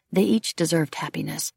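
ordinary delivery, nothing marked

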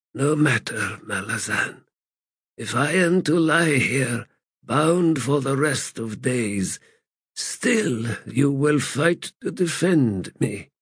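lightly voiced, weary, bitter god; a hint of a broken ancient god; frail god voice. Crippled god speaks.

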